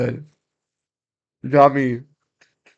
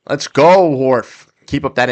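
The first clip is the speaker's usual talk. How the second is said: excited cheer; 3 semitones higher, 9 dB louder than usual. Real, not acted. Cheering on Horford.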